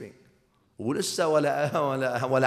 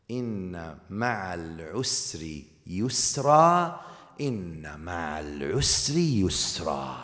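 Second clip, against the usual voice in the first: Quran recitation; 6 semitones lower than usual. Acted. Recites Quran verse twice.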